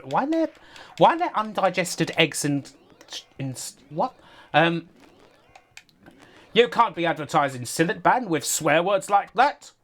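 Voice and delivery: lightly voiced ad man scolding; Barry Scott voice. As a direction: complain like an outraged TV presenter. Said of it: "I'm Barry Scott".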